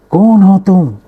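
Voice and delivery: high, loud angry shout; a boy yelling at an unseen mocker; child shouting at the mountains; angry demand. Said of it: "बच्चे ने गुस्से से पूछा".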